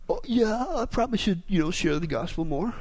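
soft, low, half-hearted mumble; guilty mumble. Retold or imitated. Preacher mimicking guilty Christians, mumbling softly. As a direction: shrug it off like a guilty churchgoer. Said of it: "you gotta talk like that when you feel guilty".

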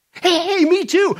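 high, loud, excited greeting; hammed-up delight. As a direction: exclaim it with comic over-eagerness. Joke character finds common ground.

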